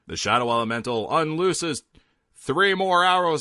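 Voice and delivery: normal talking voice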